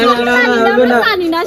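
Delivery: hammed-up loud mocking chant; mock sing-song shout. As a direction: drag out the taunt loudly. Bystanders refuse to help.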